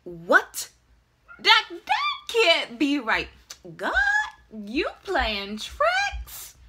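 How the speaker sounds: high, loud, incredulous outburst; shocked disbelief. Retold or imitated. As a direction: burst out in stunned disbelief. Reacts to positive pregnancy test.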